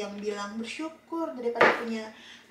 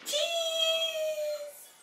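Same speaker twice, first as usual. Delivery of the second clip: very high, drawn-out cheese call; 5 dB quieter than usual. Real, not acted. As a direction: stretch it high for the photo. Mother calling for a photo.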